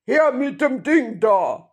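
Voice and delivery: loud, high robber's demand; gruff shout. A narrator's reading. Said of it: "eine barsche Stimme rief".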